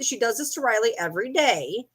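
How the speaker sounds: ordinary delivery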